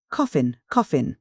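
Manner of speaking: ordinary delivery, nothing marked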